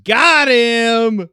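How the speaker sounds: high, very loud crow; playful drawn-out yell; triumphant shout. Pretend. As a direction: holler it in mock victory. Crowing after a rivalry jab.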